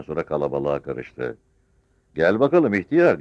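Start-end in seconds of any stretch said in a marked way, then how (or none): 2.1-3.2 loud, high captain's order; barked command. A narrator's reading. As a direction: shout it like a military command. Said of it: "komut verir gibi bağırdı". (komut verir gibi bağırarak)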